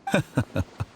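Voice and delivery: a short, amused laugh falling away. Acted. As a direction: laugh off the refusal. Amused by the refusal.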